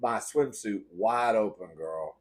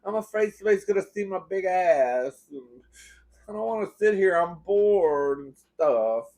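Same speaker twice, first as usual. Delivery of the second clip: put-on higher, whiny kid complaining to mom; whining; 5 semitones higher than usual. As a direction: whine like a bored, scared kid. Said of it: "I started whining".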